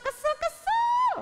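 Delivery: kesel, kesel drawn out in a very high squeal; over-the-top whiny pouting; annoyed. Acted. Sulking king.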